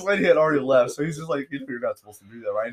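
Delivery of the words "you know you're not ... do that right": hushed and quick, holding back a laugh; suppressing laughter. Retold or imitated. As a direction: mutter it fast, trying not to crack up. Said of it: "He's trying not to crack up laughing".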